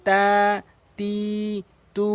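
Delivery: তা, তি, তু each vowel held long; drawn out syllables. Shown as a demonstration. Teacher drilling তা, তি, তু stretched. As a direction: stretch তা, তি, তু one count each. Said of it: "এভাবে টেনে টেনে পড়বেন".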